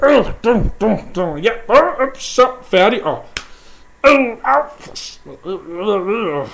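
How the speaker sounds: high, loud yelps of pain; pained wailing. Acted. Sindre yelping at Brok while being bitten. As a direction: yelp and stammer as the sting hits.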